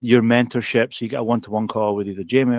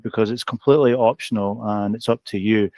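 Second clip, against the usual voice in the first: usual speaking voice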